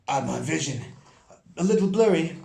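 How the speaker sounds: light high elf voice; a touch of a high elf. Acted. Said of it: "I gotta get my elf voice".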